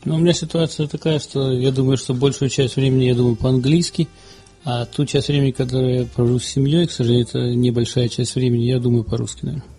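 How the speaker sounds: usual speaking voice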